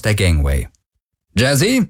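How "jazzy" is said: higher, rising call; brisk summons. A narrator's reading. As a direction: call to the ship's computer. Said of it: "rief sie".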